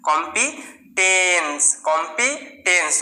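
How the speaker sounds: slow chant of কম্পিটেন্স; slow repeated demo. Shown as a demonstration. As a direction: repeat কম্পিটেন্স slowly, each take falling. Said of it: "শুধু এখানে স".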